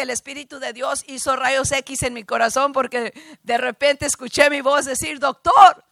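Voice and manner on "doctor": loud, higher call across a room; projected call. Retold or imitated. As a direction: call out to get the doctor's attention. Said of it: "tenía que proyectar la voz".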